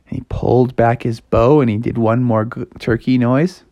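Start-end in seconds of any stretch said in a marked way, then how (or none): none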